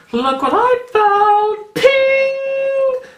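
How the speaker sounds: very high, slow, sing-song squeal; excited friend. Retold or imitated. Friend showing off her yarn find, high sing-song squeal. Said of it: "she sent me a photo going".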